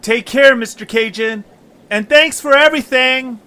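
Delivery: very high, loud cheerful farewell; calling goodbye. Acted. Young travellers calling goodbye to the captain, high and loud. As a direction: shout thanks across the water.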